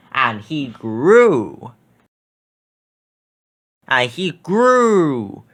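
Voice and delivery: slow growing build; slow stretch. A narrator's reading. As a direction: stretch each word as he grows.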